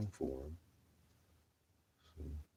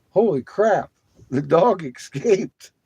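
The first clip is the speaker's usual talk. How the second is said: high, loud, laughing alarm; shocked exclamation; 12 semitones higher, 11 dB louder, slower than usual. Retold or imitated. Owner recalling the dog escaping, laughing alarm. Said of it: "I'm like".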